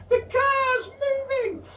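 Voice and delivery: put-on very high, slow little-boy yell; toddler shout. Toddler shouting at his dad from the car, very high. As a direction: cry it out in a panic. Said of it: "Eddie is shouting".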